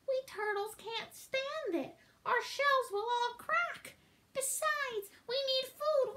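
high, pleading little turtle voice; small high complaint to a king; groaning Mac. A narrator's reading. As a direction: plead meekly from under a heavy load, rising as you go. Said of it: "groaned Mac".

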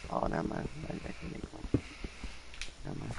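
whispered aside; muttering to self. Real, not acted. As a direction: mutter under your breath.

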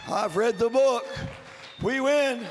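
triumphant preaching. Real, not acted. Preacher proclaims victory to congregation, loud and triumphant. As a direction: proclaim it with full force. Same assurance repeated at force.

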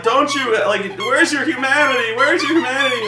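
high-pitched hammed-up pleading; mock indignant plea. Scientist begging the captain for mercy, mock indignant plea. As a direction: plead it high, imitating outrage.

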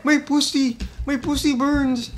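high yelps, easing in loudness; comic pained panic. Real, not acted. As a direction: cry out as if burned by the hit. Takes a big hit.

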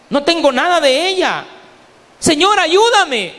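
high, pleading cry to God; preacher acting out a sinner's anguished plea; acted desperate prayer. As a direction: cry out high, as if begging for help. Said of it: "Y ojalá algún día digas".

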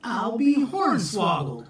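high, amazed exclamation; cried out in surprise. Acted. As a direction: Jack Huffle amazed at the kitten, surprised. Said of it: "Jack Huffle cried".

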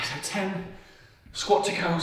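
ordinary talk

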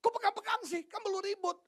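high, loud mock-irritated scolding; annoyed wife. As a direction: snap at a clingy husband, hammed up. (istri kesal, mengomel dengan suara tinggi)

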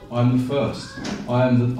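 normal talking voice

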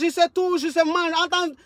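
ordinary delivery

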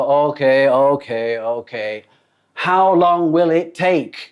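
an unhappy diner pushing the waiter for a time; impatient customer. Acted. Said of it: "ลูกค้าก็ยังไม่พอใจ".

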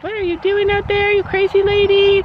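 high-pitched imitation of baffled passers-by; mock heckling onlookers. People in their car to crazy lady, mock heckle. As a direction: call out high, playing an incredulous passer-by.

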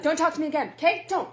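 snapping loudly at sister; sharp angry order. Acted. Sibling quarrel.

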